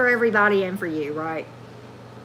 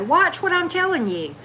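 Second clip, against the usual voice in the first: ordinary delivery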